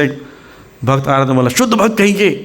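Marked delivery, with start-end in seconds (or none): 1.5-2.3 high-pitched shout of praise. Retold or imitated. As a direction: praise said high, sounding like a scold. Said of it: "क्योंकि pitch high था".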